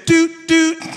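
high, loud doot doot horn imitation; mock horn beep. Preacher mimicking a rival driver's horn, high and loud.

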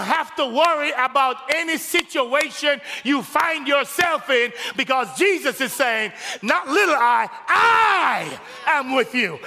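high, loud, slow preaching shouts; full-force preaching. Acted. Pastor assuring congregation God is with them, preaching hard. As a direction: lift the whole church with it.

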